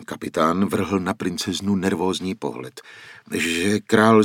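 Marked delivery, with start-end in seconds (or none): none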